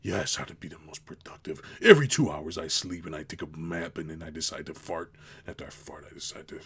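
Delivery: low voice fading to a whisper; mocking a productivity guy, ending in a whisper. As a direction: talk low, then drop into a whisper.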